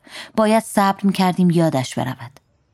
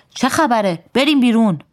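raised, higher-pitched scolding father; father's shout; 6 semitones higher than usual. A narrator's reading. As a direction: narrator voicing a father's mild yell. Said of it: "پدر داد زد".

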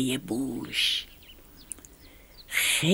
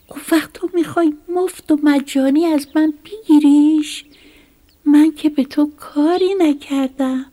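high, rushed, louder protest; upset pleading; 11 semitones higher, 6 dB louder than usual. A narrator's reading. Mouse protesting to the snake who took his home, high and upset. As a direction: plead with rising distress. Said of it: "موش با ناراحتی گفت".

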